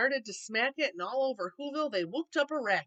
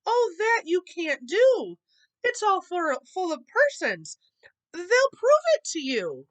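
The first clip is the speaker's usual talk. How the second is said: alarmed pleading; 7 semitones higher, 7 dB louder than usual. A narrator's reading. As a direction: beg them to stop, alarmed.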